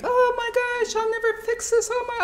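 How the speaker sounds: high, frantic worry voice; imitating a frightened inner voice; panicky inner voice. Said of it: "I'm not gonna think".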